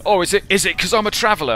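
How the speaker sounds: loud, high, fast imitated complaint; mock indignant protest. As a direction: imitate an aggrieved man being ushered out. Retold protest of man being ejected.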